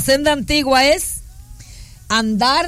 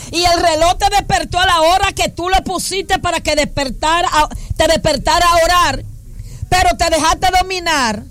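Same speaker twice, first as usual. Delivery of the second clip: preaching; 6 semitones higher than usual. Real, not acted. Preaching at full force.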